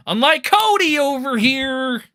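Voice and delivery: high, loud taunting jab; sing-song dig at a rival; mocking jab. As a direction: streamer taunting Cody for chat.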